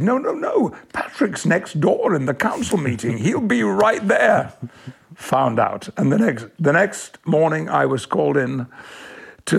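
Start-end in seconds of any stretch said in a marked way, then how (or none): 0.0-4.5 high, eager no, no, no, then settling; brushing off the editor with sudden pitch; retold sub-editor's quick objection. Sub-editor assuring the editor Patrick is nearby, eagerly. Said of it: "And the sub-editor said".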